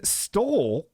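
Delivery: stole stretched very slow; drawn-out stole. Shown as a demonstration. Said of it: "speaking of, stole".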